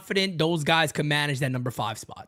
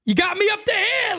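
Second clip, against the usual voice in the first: high-pitched, worked-up imitation of dad; exasperated father; 4 dB louder than usual. Host quoting his fed-up father, exasperated. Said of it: "like my father used to tell me".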